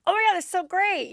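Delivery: high, eager gush of delight; mock delighted gush. Guy thinking he's still headed to the Mansion, mock delighted. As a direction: gush with put-on thrill.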